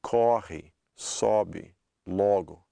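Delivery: "Corre, sobe, logo" word by word, each stressed o as aw; open O. Shown as a demonstration. Teacher pointing out the sound, open o in "Corre, sobe, logo". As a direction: separate "Corre, sobe, logo", opening each first vowel. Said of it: "Every one of them is that ah sound".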